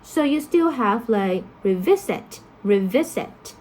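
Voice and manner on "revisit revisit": revisit stressed on VIS; stress unchanged by prefix. Shown as a demonstration. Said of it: "the stress is still the same".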